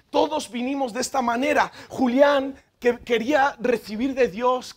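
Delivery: usual speaking voice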